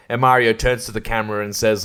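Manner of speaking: ordinary talk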